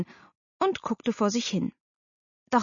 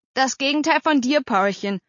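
brother teasing his sister, higher at first; mocking; 6 semitones higher, 4 dB louder than usual. A narrator's reading. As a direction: needle your little sister. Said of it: "spottete Bernhard". (spöttisch)

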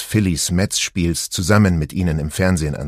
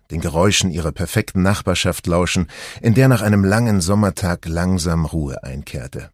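ordinary delivery, nothing marked

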